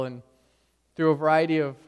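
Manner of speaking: ordinary talk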